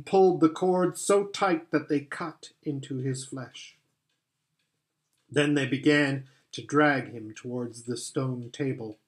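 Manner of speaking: usual speaking voice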